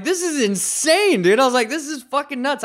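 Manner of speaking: laughing at the chaos, hyped; thrilled disbelief. Retold or imitated. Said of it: "I'm like".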